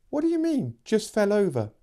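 high disbelieving question; incredulous. Acted.

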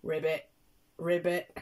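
short, punchy frog ribbits; croaky frog. Acted. Nursery teacher playing Croaky Frog, playful ribbit croaks. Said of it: "it's Croaky Frog".

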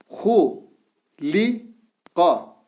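Gu said with a thick back U, each syllable drawn out; syllable drill with thick vowel. Shown as a demonstration. Teacher reads the thick-vowel syllables to students, slowly. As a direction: read out each syllable apart. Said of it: "Ha harfi kalın harf".

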